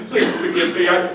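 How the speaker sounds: ordinary delivery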